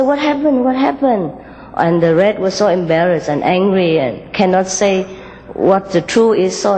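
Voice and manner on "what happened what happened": higher, worried asking; concerned green character. Acted. Green rushes to crying red, asking in higher concern. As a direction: call out in lifted concern.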